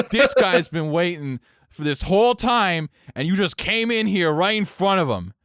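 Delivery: hammed-up accusing rant; indignant complaint. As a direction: imitate a drunk arguing on someone's behalf. Acted drunk's angry complaint.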